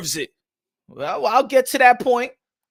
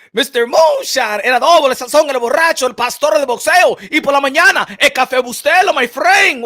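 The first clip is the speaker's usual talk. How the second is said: hype announcer; 4 dB louder than usual. Acted. Hypes own nicknames like an announcer.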